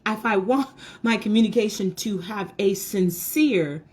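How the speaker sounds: ordinary delivery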